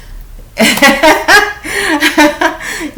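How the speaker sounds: high-pitched helpless laugh; sudden high laugh at a sad thought; real burst of laughter. Real, not acted. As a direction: laugh out before catching yourself. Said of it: "I don't mean to laugh".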